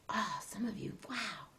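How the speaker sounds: soft whispered wonder; whispering. Real, not acted. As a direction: hush to an awed whisper. Quiet aside watching kids.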